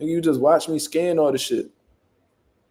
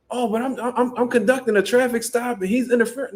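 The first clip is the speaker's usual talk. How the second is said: higher-pitched stammered mock objection; imitated flustered protest; 8 semitones higher than usual. Officer defends her traffic stop, imitated flustered protest. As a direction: imitate her trying to justify herself.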